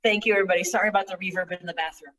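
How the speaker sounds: ordinary talk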